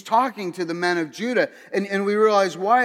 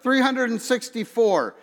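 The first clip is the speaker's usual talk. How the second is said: higher-pitched called-out number; mock yell; 6 semitones higher than usual. Prisoner calling a joke's number to the yard, mock yell. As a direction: call it out across a yard, without real volume. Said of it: "he yells out".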